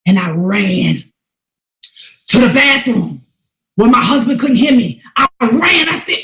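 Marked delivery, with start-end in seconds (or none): none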